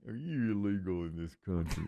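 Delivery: lightly imitated TV interrogator; Doctor Phil. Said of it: "Doctor Phil goes over. He's like".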